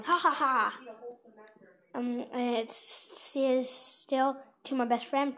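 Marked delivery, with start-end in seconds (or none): none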